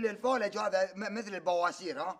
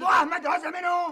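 shout; 6 semitones higher, 7 dB louder than usual. Acted. Comic shouting match.

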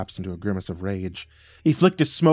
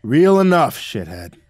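loud sudden retort; snapping back; 4 semitones higher, 10 dB louder, slower than usual. A narrator's reading. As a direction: fire it back at him. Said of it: "Dad shot back".

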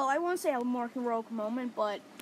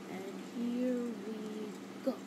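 slow Joker line; 4 dB quieter, much slower than usual. Retold or imitated. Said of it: "Joker's just like".

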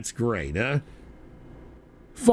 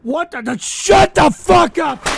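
a raging, much higher, much louder shout, slowed on the words; furious yell; 8 semitones higher, 6 dB louder than usual. Real, not acted. Streamer screaming at a disgusting donation, furious shout. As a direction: explode at the chat in full fury.

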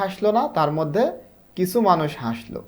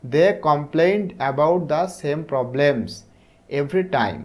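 each word laid out very slowly; slow story reading; much slower than usual. Shown as a demonstration. Teacher reading the story's first line to pupils, very slow. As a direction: read the opening line slowly for the class.

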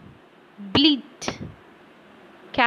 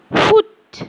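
loud high hoot, much higher; owl hoot; 11 semitones higher, 7 dB louder than usual. Shown as a demonstration. Imitating an owl sound.

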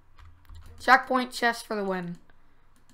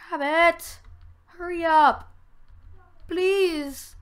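raised, higher pleading calls; calling to hurry; 8 semitones higher, 3 dB louder, much slower than usual. Real, not acted. Urging teammate Rabbit to hurry over, pleading calls. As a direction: call him over, urgent.

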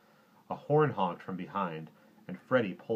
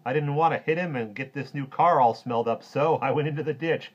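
loud, high, jovial hero voice; projected, raised-pitch comic hero; amused superhero; 8 semitones higher, 12 dB louder than usual. A narrator's reading. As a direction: tell the mishap with big amusement. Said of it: "said the Super Duper and laughed".

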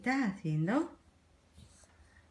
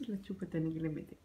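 soft laughing aside; 4 semitones lower, 10 dB quieter than usual. Real, not acted.

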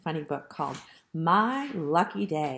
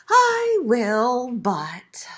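higher start, falling away on but; reluctant piglet, tailing off; sighing piglet; 4 semitones higher, 5 dB louder, much slower than usual. A narrator's reading. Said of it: "sighed the piglet".

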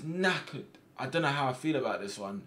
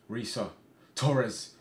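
ordinary talk, nothing marked

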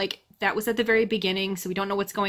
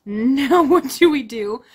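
loud, higher-pitched what-now reaction; mock bewilderment; 4 semitones higher, 9 dB louder, slower than usual. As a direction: play up the helpless question, louder. Voices reaction to the apocalypse.

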